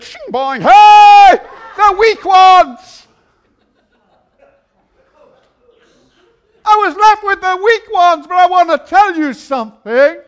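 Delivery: loud high slow preaching; preaching at full force. Acted. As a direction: preach it out big. Preaching crescendo.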